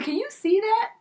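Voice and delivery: high-pitched, flustered question; mock alarm at being found out; panicking. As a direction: pitch jumps up in dismay. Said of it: "I am panicking so much".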